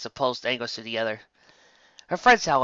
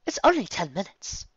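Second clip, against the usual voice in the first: higher, calmer; lightly voiced Rarity; 8 semitones higher than usual. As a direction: soothe the hurry.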